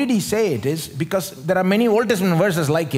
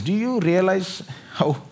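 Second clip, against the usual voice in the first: ordinary talk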